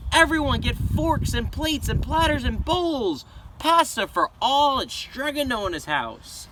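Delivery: high loud public call to the crowd; shouting. A narrator's reading. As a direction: call out to the whole town square. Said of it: "and shouted".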